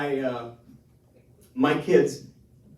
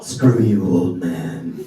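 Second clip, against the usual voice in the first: surly son; 6 semitones lower, 11 dB louder, much slower than usual. Retold or imitated. Grown son snaps at dad.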